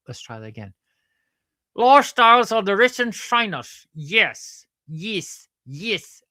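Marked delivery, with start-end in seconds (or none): 1.8-6.2 high, loud Australian-accent announcement; attempted Australian accent. Shown as a demonstration. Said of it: "try to do an Australian accent".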